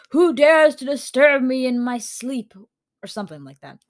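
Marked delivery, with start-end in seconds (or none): 0.1-2.5 loud, raised roar of a demand; monster's yell. A narrator's reading. Squid monster in a tall tale, yelling at whoever woke it. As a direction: bellow it like a monster woken up. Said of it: "it would yell".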